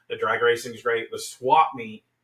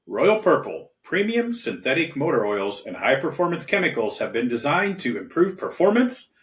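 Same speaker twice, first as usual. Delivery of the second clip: upbeat, higher commercial read; 4 semitones higher, 3 dB louder than usual. Acted. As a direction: sell it like a radio spot. Reads sponsor ad.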